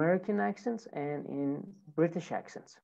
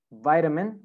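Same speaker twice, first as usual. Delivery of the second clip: American "vitamin", first syllable like "vite"; American accent. Shown as a demonstration. Teacher models American "vitamin" for students, long I.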